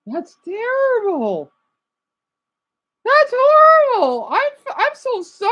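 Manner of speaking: overacted shock at the footage; dramatic horrified. Real, not acted. Dramatic shocked reaction to clip.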